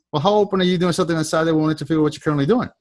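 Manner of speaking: rattled off fast and smooth; quick pitch line. Shown as a demonstration. Sales coach showing his team the smooth pitch, quick and fluent. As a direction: fire off the recruiting question in one easy breath. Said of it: "You see how that comes out of my mouth?".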